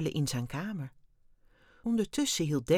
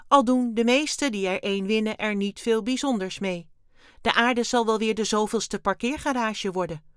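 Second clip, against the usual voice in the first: higher, louder alien voice, matter-of-fact; breezy alien Wim; 5 semitones higher, 6 dB louder than usual. A narrator's reading. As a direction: explain offhandedly as the little alien. Wim explains on. (luchtige, hoge ruimtewezenstem)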